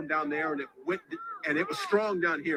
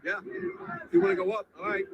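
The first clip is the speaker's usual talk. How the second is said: ordinary delivery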